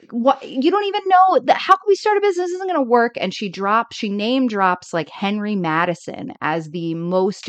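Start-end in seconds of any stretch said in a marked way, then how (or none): 0.1-3.1 high, alarmed protest; pitched-up fretting friend; mock panic. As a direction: play the frantic worrier. Kathy panicking, quote introduced.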